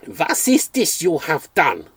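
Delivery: put-on spluttering, over-the-top German accent, slow; a dream vet in a ham German accent, aghast; corny German accent. Dream vet accusing the narrator, spluttering in corny German accent. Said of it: "such a completely corny accent".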